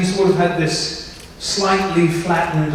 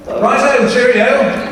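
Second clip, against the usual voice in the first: raised, upper-class English cheerio; posh English; 6 semitones higher, 3 dB louder, slower than usual. Acted. Actor tossing off toffee-nosed goodbyes in character. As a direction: chirp it like a toff.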